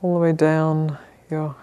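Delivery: calm, measured meditation guidance with long pauses; unhurried, even instructions, leaving space to settle; guided meditation. Real, not acted. Teacher settling a group into sitting posture, calm guided meditation.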